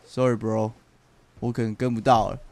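"sorry bro" dropped low and casual to sound cool; acting cool. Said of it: "我还要用那种很帅".

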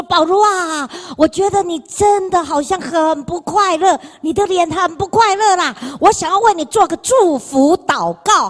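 lightly voiced caring older sister; voicing Mary. Voicing Mary's kind invitation.

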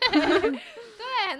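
laughing high and loud. Real, not acted. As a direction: burst out laughing. Laughing at shared joke.

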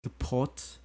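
slow, careful try at a British accent, rounded o; attempted British accent. Shown as a demonstration. Student tries the British accent the teacher showed. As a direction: haltingly copy the British accent. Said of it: "You say you wanna 装逼".

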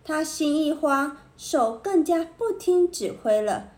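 ordinary delivery

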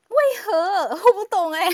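high, loud shocked outcry; shocked disbelief. Real, not acted. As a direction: cry out in disbelief. Shocked reaction to intimate details.

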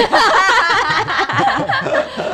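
high burst of laughter; loud laugh. Real, not acted. No listener questions.

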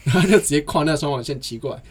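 ordinary talk, nothing marked

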